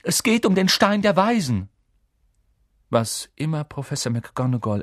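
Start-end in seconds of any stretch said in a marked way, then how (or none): 0.0-1.7 high, loud urgent outburst; emphatic, pressing appeal; throwing caution away. A narrator's reading. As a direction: blurt out the secret. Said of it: "ließ alle Vorsicht fahren".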